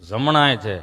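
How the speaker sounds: low, hesitant mock stammer, catching before words; stammering friend. Friend answering the nurse, imitated low stammer. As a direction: hum and stick, then answer low. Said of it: "જીભ જલાઈ ચોંટે અટકે".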